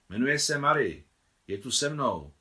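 excited call into the receiver; calling into telephone. A narrator's reading. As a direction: announce it loudly down the line. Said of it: "vykřikla do telefonu Nicole".

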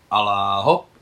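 very loud cry of alá hop; sudden shout. A narrator's reading. Nicole diving from the horse into the sea, sudden loud shout. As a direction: yell it out as you dive headfirst. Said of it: "vykřikla najednou Nicole".

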